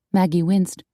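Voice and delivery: plain reading voice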